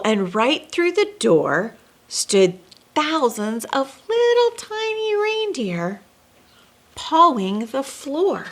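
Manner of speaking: lilting read-aloud storybook voice; storyteller reading rhyming verse; story narration. A narrator's reading. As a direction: read it like a bedtime rhyme to kids. Reads rhyming Christmas story aloud.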